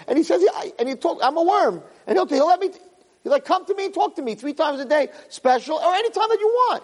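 high-pitched, insistent sermon delivery; impassioned preaching. Real, not acted. Rabbi urging the room to talk to God, impassioned preaching.

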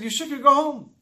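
high, loud acted dismissal; retold rebuke. Eli scolds a praying woman as drunk, loud retold rebuke.

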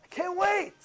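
high, gleeful acted outburst; mock pumped-up anticipation; fired up. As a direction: cheer it on eagerly. Acting out eagerness to study.

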